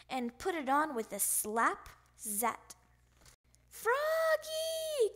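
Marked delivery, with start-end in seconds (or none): 3.7-5.1 put-on father summoning his child; calling out. A narrator's reading. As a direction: holler the name as if across the house. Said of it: "called his father".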